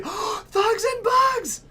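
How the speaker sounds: real excited scream; gasping shout. Real, not acted. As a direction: gasp then a very high slow yell. Sudden excited gasp and shout.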